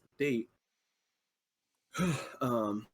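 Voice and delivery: breathless, a heavy sigh between words; catching breath mid-sentence, out of breath. Real, not acted. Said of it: "sorry, out of breath".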